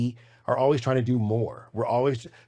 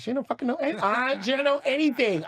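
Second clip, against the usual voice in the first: stunned realisation; 12 semitones higher than usual. Retold or imitated. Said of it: "Then I realized".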